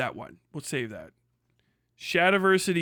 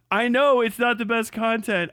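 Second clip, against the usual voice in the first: ordinary talk, nothing marked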